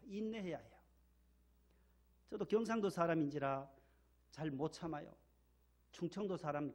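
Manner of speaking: ordinary talk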